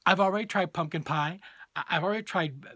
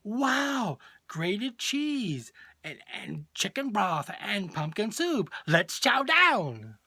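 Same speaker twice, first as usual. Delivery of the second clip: mock-excited kids, high, drawn-out words building to a loud finish; young boys cheering; 6 semitones higher, 4 dB louder, much slower than usual. Mother imagining her three boys at the table, mock-excited. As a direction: gush like boys thrilled by dinner. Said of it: "having them go".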